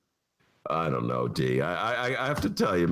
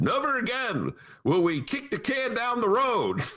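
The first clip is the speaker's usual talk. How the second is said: quoting the mayor; 11 semitones higher than usual. Retold or imitated. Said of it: "she said".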